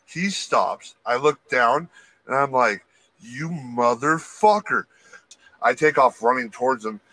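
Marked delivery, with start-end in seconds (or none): 3.2-4.9 slow, drawn-out retold curse; stunned mock outrage. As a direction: stretch each word in slow disbelief. Retold reaction to being injured.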